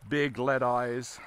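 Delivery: normal talking voice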